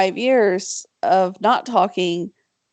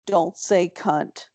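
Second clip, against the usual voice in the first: slow, lower retold warning; mock admonishing; 5 semitones lower, much slower than usual. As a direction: spell it out slowly, like a husband's warning. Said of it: "and he's like".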